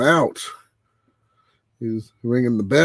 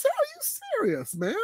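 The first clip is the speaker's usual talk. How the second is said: very high, slow, drawn-out and softer; incredulous; 8 dB quieter than usual. Retold or imitated. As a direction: stretch it out high in utter disbelief. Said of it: "I'm like".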